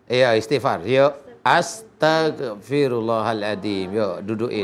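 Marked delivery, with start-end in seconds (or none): 1.4-3.9 low, drawn-out prayer phrase; slow recitation. Shown as a demonstration. Healer leading Mbak Putri in istighfar, slow recitation. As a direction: stretch each syllable to be repeated.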